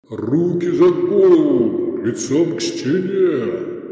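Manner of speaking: drawn-out, overpowering bellow; monstrous booming voice. A narrator's reading. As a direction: thunder the orders from on high. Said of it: "Чудовищный бас рушился сверху".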